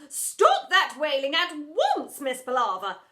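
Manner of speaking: loud, slow command; ordered. Acted. Headmistress ordering the teacher, loud and slow. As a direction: order her to stop. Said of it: "ordered the headmistress".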